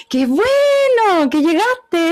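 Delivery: high, slow, sweeping tune on "Qué bueno que llegaste"; long upward melody. Shown as a demonstration. Teacher models the warm informal melody for the class, high and slow. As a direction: lift "bueno" high and draw the phrase out slowly. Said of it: "larguita hacia arriba".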